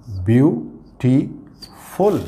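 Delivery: Beautiful drawn out very slowly, each syllable apart; slow teacher's beautiful, syllables pulled apart. Shown as a demonstration. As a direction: stretch out beautiful to count its three syllables. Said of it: "तीन syllable का word है".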